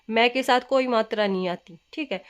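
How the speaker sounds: ordinary talk, nothing marked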